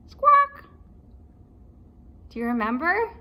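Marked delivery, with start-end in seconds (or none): 0.1-0.6 very high loud bird squawk; loud parrot cry. Acted. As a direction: screech like a parrot. Parrot noise.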